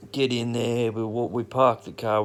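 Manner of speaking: ordinary talk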